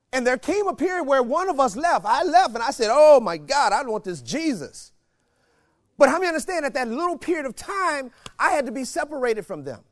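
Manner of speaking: preacher warming up; building preaching. Acted. Rising sermon intensity.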